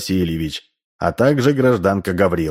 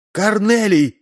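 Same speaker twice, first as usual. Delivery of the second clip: very high call for a husband; high-pitched cry without full shouting force; wife crying out; 13 semitones higher, slower than usual. A narrator's reading. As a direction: call out in alarm, pitched right up. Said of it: "закричала Ксения".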